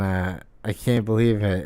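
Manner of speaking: usual speaking voice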